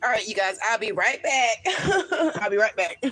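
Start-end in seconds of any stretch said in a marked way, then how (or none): 1.6-2.4 high, bursting embarrassed giggle; flustered high laugh. Real, not acted. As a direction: laugh it off after crying. Embarrassed after tearing up.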